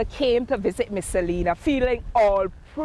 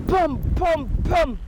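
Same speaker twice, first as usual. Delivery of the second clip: knocking sound; 6 semitones higher than usual. Acted. Knocking on the door.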